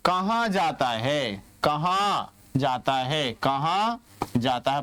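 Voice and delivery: कहाँ drawn out slowly and stressed; slow teacher's question with weight on कहाँ. Shown as a demonstration. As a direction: ask कहाँ जाता है slowly, leaning on कहाँ. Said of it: "प्रश्न बन गया".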